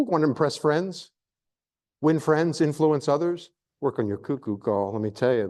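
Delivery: usual speaking voice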